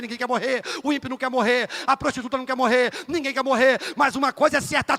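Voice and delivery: ordinary talk, nothing marked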